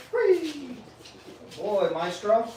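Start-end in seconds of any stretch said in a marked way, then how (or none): 0.1-0.8 high, loud single "Free"; sharp release command. Real, not acted. Trainer releasing his dog from the wait, sharp command. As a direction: snap the release word out.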